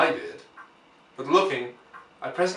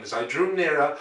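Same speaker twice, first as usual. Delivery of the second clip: ordinary delivery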